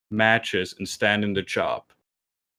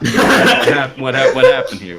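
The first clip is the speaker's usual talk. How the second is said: surprised; 4 semitones higher, 8 dB louder than usual. Retold or imitated. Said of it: "And I'm like".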